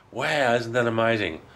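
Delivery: ordinary delivery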